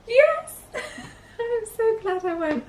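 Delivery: laughing, high and slow. Real, not acted. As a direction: laugh through the answer. Asked if glad she went.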